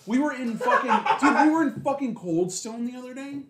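giggling while telling it; laughing. Real, not acted. Friend laughs while telling the ice cream story, laughing.

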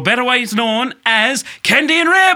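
big rising showbiz introduction of the guests; hyped announcer intro. Acted. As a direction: announce them like a ringside host. Hosts introducing guests on podcast.

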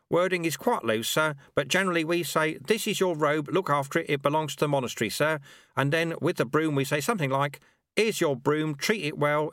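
put-on high, eager old servant voice; keen little monk offering help; eager helpful sweeper. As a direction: rush to be helpful, sir-ing everyone. Lutze explains ritual.

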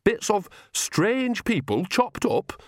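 very high, slow, appalled question; horrified. A narrator's reading. Visitor learns of chopped-up people, appalled and horrified. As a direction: pitch it way up in disbelief.

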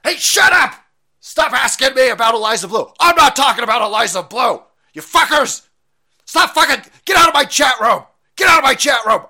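screamed high and loud, hammed-up; a streamer mocked for yelling at his chat, screaming high and loud; mock Tim rant. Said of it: "were of him going".

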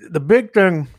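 talking while chewing candy; words round a mouthful of candy; mouth full. Real, not acted. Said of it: "Sorry, I'm eating M and M's while I'm doing this".

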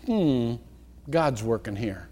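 soft, low, unhurried and reassured; calm trust. Shown as a demonstration. Preacher shows how to meet threatening change, soft and calm. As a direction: let it settle, quiet faith. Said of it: "I want you to say".

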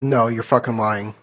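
ordinary delivery

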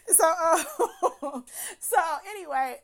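real laughter over the restart; laughing. Real, not acted. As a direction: push on while still giggling. Laughing at her own slip.

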